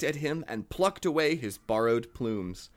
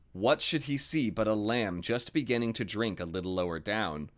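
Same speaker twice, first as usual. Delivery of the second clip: ordinary delivery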